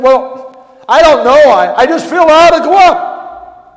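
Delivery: loud, high-pitched retold plea; urgent plea. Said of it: "I didn't scream at her".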